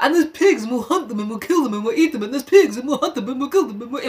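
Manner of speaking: breathless run-on chant, circling on itself; mock a boy who won't shut up; imitating an obsessive hunter on a loop; obsessive rambling. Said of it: "So Jack just keeps talking about".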